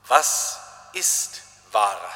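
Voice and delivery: low, slow, weary Pilate asking; Pilate's question. Acted. Pilate questioning Jesus at the trial, low and slow. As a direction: ask it like a tired governor, slowly and low. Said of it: "Und der Pilatus fragt".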